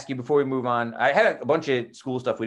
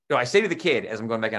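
ordinary delivery

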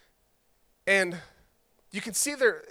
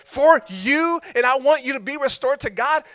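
louder, fervent vow; Jesus standing up for us; 4 semitones higher, 9 dB louder than usual. Retold or imitated. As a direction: preach his promise with full conviction. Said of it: "he got upset and he says".

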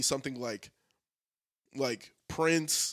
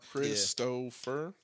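very slow, low and soft, syllable by syllable; labored, spelled-out delivery; drawn-out name; 6 semitones lower, 8 dB quieter, much slower than usual. Shown as a demonstration. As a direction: stretch the name out to show how long it is. Showing a long name is tiresome.

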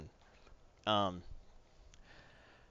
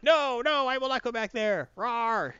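higher, defiant villain with a mock roar; crazy assistant; 9 semitones higher, slower than usual. Assistant refusing the heroes, higher and defiant. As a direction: refuse and growl like a madwoman. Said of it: "She's like".

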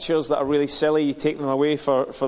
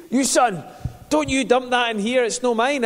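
angry neighbour shouting; 8 semitones higher than usual. Retold or imitated. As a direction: bellow down a warning at the man below. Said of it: "he's shouting down at me".

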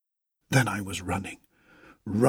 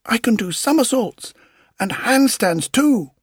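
gleeful boast; 12 semitones higher, 6 dB louder than usual. A narrator's reading. Puppet boasts.

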